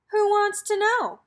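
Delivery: high, loud challenge from a storybook guard; growled challenge. A narrator's reading. Voice from inside the shack challenging a visitor, mild growl. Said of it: "growled a voice from inside".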